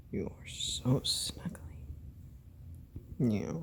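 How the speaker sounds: soft slow whisper to the cat; hushed cooing whisper. Real, not acted. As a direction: breathe it to her softly.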